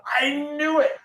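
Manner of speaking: high excited voice of the architect; mimicking the architect. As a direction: pitch way up as the eager fan. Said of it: "He went".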